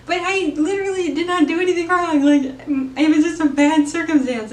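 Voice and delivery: defending yourself, hurt and exasperated; indignant pleading. Retold or imitated. Son pleads with dismissive mom.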